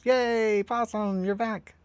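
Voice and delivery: very high, sing-out welcome; mock cheering. Streamer voicing a welcome back for possum, high cheer.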